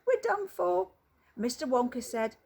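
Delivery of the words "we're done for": high, loud cry of doom; terrified shout. Acted. Grandpa Joe cries out as the lift bursts the roof, high terror. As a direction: scream for help as the roof breaks. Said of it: "Grandpa Joe shouted".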